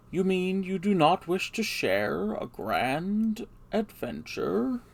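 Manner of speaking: Gandalf voice. Acted. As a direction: speak as the grand old wizard to Bilbo. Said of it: "trying to do everyone's voices".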